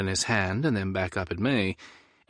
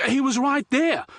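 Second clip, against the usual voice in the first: much higher, loud indignant cry; outraged shout; 4 dB louder than usual. Acted. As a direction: yell it in shocked outrage. Liam outraged fish escaped.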